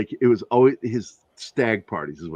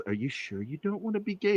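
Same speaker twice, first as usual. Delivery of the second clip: softer, confiding ask; leaning-in question; 7 semitones higher, 10 dB quieter than usual. Retold or imitated. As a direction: lean in and drop your voice. Friend leaned in to ask.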